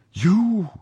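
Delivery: very high, held, incredulous "you?"; repeated cry. A narrator's reading. Prisoner challenges her captor, incredulous cry. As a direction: press the disbelief harder. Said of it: "repeated I".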